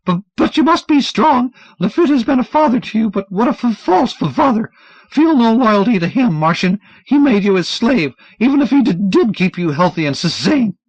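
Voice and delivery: high, urgent stammering plea. Acted. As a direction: plead fast and nervous.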